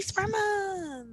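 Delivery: slow, high, drawn-out cheer; excited cheer. Real, not acted. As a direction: announce it like a celebration. Cheering Pride Month.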